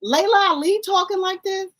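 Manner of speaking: high-pitched disbelief, easing down at the end; appalled, pitch way up; incredulous. Real, not acted. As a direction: squeak out your disbelief. Appalled comparison with other professionals.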